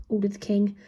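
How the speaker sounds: usual speaking voice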